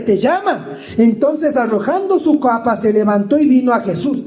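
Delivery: emphatic pulpit reading in punched phrases; declaimed scripture reading. Real, not acted. Preacher reading the Bartimeo story to the congregation, declaimed scripture. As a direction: proclaim the Bible story to the whole church.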